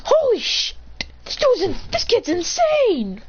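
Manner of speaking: high-pitched amazed exclamation; stunned outburst. Acted. Endeavor stunned by the fire beam, high amazed outburst. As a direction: break off and restart in awe.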